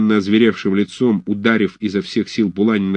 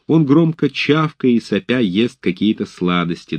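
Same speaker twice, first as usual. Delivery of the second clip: ordinary delivery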